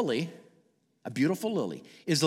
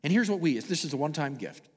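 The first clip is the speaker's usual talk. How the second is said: ordinary talk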